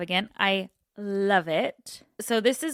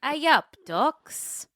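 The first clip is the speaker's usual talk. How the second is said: lightly voiced Yorkshire, pitched up; Yorkshire accent; 7 semitones higher, 4 dB louder, slower than usual. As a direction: try a broad Yorkshire greeting. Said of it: "Strong Yorkshire accent required".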